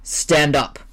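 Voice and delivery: a curt, commanding order; firm order. Acted. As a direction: tell him flatly to get up. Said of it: "ordered Waldemar".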